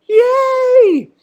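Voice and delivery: very high, loud squeal; mock cheer. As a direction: squeal with hammed-up fake delight. Mock cheer after belittling the console.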